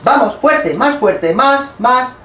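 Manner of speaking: high loud push command; rally her through the contraction; loud urging. Real, not acted.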